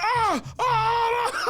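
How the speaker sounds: high, loud wailing shrieks; acted screaming. Reenacts getting soaked by water.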